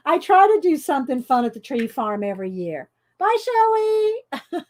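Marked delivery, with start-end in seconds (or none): 3.2-4.6 high, slow drawn-out bye; sing-song goodbye. Real, not acted. Waving off viewer Shelley, high drawn-out goodbye. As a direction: stretch the farewell up high.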